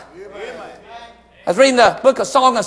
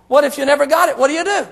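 ordinary delivery

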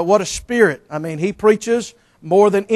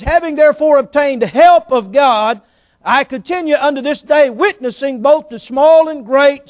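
scripture reading; 6 semitones higher, 6 dB louder than usual. Acted. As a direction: proclaim the verse from the pulpit. Reads the scripture aloud.

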